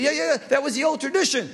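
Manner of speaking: high-pitched, comic agreement; exasperated old man. Acted. Old man answers the arguing factions, high comic punchline. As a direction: pipe up high as the addled elder.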